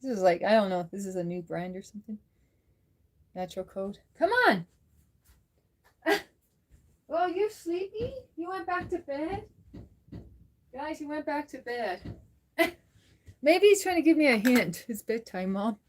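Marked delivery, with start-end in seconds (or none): 4.2-4.6 high little call to the cat; high, swooping pet call; coaxing a pet. Real, not acted. Calling the cat to food. 7.1-12.1 high, soft pet-talk; tender, high little questioning voice; cooing to pet. Real, not acted. Talking to a sleepy cat.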